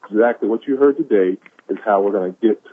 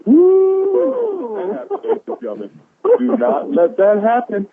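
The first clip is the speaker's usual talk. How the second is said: excited whoop; slower than usual. Real, not acted. Caller fired up by a quote.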